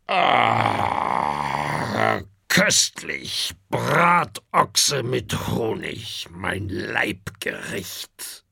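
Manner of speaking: slow, hoarse greedy dragon relishing his meal. A narrator's reading. Greedy father dragon savouring his roast ox, hoarse. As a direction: savour each word like a glutton dragon. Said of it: "sagt der Fressdrache heiser".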